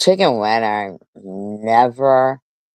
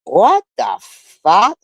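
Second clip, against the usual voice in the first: shocked outburst; 9 semitones higher, 4 dB louder, slower than usual. Real, not acted. Reacting to shocking comment.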